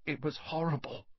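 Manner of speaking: soft, high, timid voice; gulping dread. A narrator's reading. Boy recalls his Gran's howler, gulping with dread. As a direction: recall it with a frightened hush. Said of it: "he gulped".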